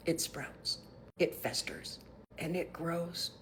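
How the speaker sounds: hushed whisper; ominous whispered warning. Real, not acted. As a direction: let it creep out in a whisper.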